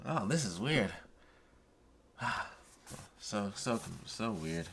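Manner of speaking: ordinary talk, nothing marked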